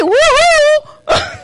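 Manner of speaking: a high, loud mock celebration whoop; mock cheer. Preacher mocking a cheer over sin, mock whoop. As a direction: cheer like it's good news, as a joke.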